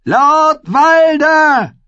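drawn-out high shout; scream. A narrator's reading. Mother calls the lord a second time, long scream. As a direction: yell the name slowly, at full force. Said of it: "schrie sie".

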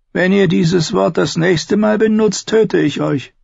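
Tyrion's voice. Acted.

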